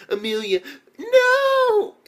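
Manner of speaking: high, loud cry of protest; acted desperate shout. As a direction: yell out in mock desperation. Acting out Amelia's dramatic cry.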